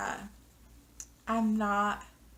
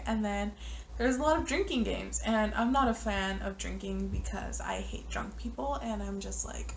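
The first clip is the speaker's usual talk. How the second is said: ordinary talk, nothing marked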